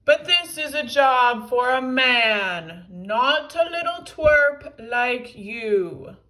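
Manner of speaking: loud, drawn-out sneer; sneering villain. A narrator's reading. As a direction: talk down to the little twerp. Super Mega Tippy sneers at little one.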